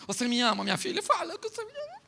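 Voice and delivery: a pleading mother, rising high at the end; mock sweet mother. Preacher mimics a modern mother begging her daughter for love, sugary. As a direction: coo the question like a needy mom. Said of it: "Você lembra da sua mãe perguntando".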